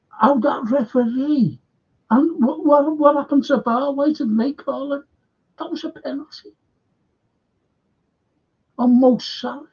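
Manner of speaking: high-pitched, indignant protest at the ref; outraged fan. Real, not acted. Fan outraged at a penalty call.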